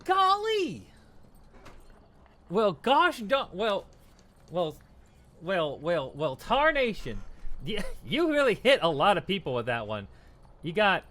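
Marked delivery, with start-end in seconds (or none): 0.0-7.2 hillbilly voice. Pretend. Folksy exclamations, put-on voice.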